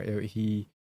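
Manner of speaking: ordinary talk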